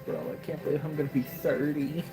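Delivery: ordinary talk, nothing marked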